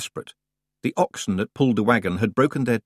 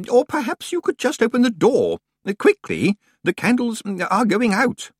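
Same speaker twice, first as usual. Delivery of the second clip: a lightly raised fussy librarian voice; Deputy Librarian Grisler; 13 semitones higher, 3 dB louder than usual. A narrator's reading. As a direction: read it out like a worried bookish clerk. Grisler voice, urgent.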